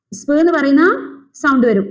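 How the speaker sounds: ordinary delivery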